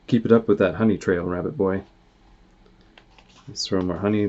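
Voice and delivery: ordinary delivery, nothing marked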